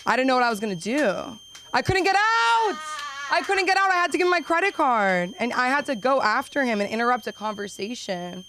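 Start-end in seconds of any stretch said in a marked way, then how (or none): 1.7-5.3 mock panic. Dramatic panic, repeated.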